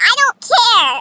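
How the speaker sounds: loud, high mock retort; hammed-up defiance; defiant outburst. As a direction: imitate a girl snapping back loudly. Girl's acted defiance.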